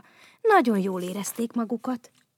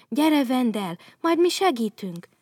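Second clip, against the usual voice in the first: higher, louder helpful call; eager call; 5 semitones higher, 5 dB louder than usual. Acted. Bogyó and Babóca running to help Vendel, eager call. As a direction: rush in to help. Friends rushing to fallen Vendel.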